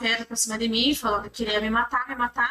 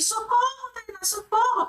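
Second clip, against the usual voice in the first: retold cry for help; 10 semitones higher, slower than usual. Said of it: "eu gritava assim".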